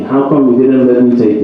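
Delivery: ordinary talk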